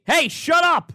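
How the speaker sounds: hammed-up mock yell of a wife; retold scream. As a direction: play the wife yelling from upstairs. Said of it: "my wife this week started screaming at me".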